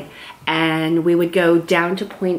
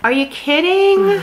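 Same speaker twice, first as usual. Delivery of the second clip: high, drawn-out disbelief; incredulous; 12 semitones higher, 3 dB louder, slower than usual. Real, not acted. Knitter answering her friend's crochet story, incredulous. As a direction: stretch it out, pitch leaping way up. Mock disbelief at the other's remark.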